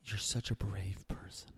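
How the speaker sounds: breathy whispered mock awe; mock reverent whisper. Mocking admirers of the vaccinated, in a hushed awed whisper. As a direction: whisper it like hushed hero worship.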